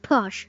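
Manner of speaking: one-syllable Porsche, like "porsh"; wrong English Porsche, silent E; Porsche with the E dropped. Shown as a demonstration. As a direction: say Porsche without its final vowel. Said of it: "Pronounce the E".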